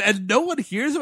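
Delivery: usual speaking voice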